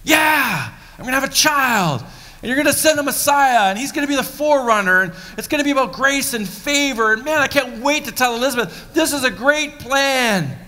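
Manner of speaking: high, loud eager rush; hammed-up giddy excitement; excited Zechariah. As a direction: gush like a man who just got great news. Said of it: "this is how I think about it".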